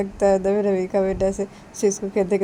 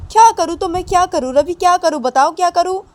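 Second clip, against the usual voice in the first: high, loud frustrated shout; चिल्लाता हुआ; 11 semitones higher, 10 dB louder than usual. Acted. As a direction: shout it out, at your wits' end. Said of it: "चिल्ला क्यों रहे हो तुम". (ऊँची, तेज़ झुंझलाई हुई चीख)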